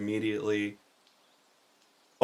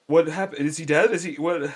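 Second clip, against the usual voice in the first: high, louder startled questions; acted alarm, pitched up; alarmed unicorn; 7 semitones higher, 6 dB louder than usual. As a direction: blurt out confused, anxious questions. Unicorn learns attacker is gone.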